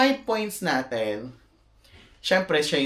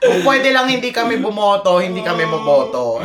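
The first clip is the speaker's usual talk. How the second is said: pleading disclaimer; 6 semitones higher, 8 dB louder than usual. Real, not acted.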